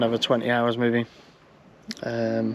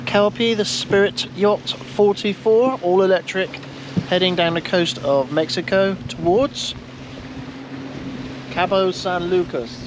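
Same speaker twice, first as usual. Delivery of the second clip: higher-pitched, drawn-out voyage announcement; announcer-style narration; 6 semitones higher than usual. Acted. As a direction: announce the journey like a grand voice-over. Mock documentary voice-over to camera.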